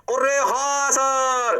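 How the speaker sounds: high, very drawn-out "yes sir"; stretched singsong realization; mock student agreement. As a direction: chant it slowly like a whole class. Acts out class's drawn-out agreement.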